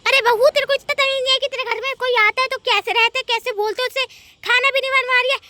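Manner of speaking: very loud, high-pitched tirade; furious scolding. Acted. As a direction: rail at her and threaten. Mother-in-law threatens the bahu. (ग़ुस्से में चिल्लाकर धमकाना)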